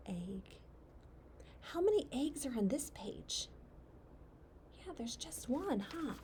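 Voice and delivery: soft, whispery storytelling; hushed whisper. Acted. Reader shows a child the quiet little egg, hushed whisper. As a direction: whisper it as if not to disturb the egg.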